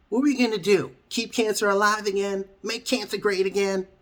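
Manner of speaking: high, loud mocking rant; exasperated yelling. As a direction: shout the accusation in disbelief.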